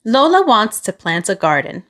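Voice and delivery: usual speaking voice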